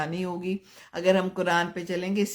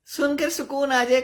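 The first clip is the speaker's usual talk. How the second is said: higher and louder, jाए lifted at the end; emphatic repeat; 8 semitones higher, 5 dB louder than usual. Real, not acted. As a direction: echo the words back with energy. Repeats request, answering it. (ज़ोर देकर ऊँची आवाज़ में दोहराना)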